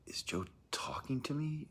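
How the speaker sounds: retold bewilderment, pitch jumping well up; puzzled disbelief. Recalling wondering if Joe was talking, puzzled disbelief. Retelling own startled question.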